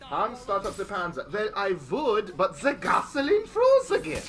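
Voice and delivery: put-on loud, high German-accented soldier skit; mock German accent. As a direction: play hammed-up German soldiers, rising at the end.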